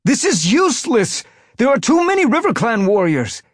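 high, loud, alarmed yowl. A narrator's reading. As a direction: cry out that the fight is lost. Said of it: "The yowl came from a warrior".

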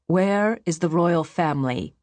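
firm, imperious demand; demanding question. A narrator's reading. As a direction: demand an answer with authority. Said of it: "the high priest demanded".

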